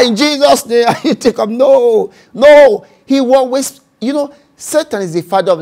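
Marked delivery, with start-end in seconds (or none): none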